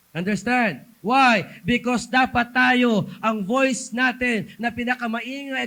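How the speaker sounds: loud, pressing sermon delivery; forceful loud preaching; preaching at full force. Acted. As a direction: drive the point home to the congregation.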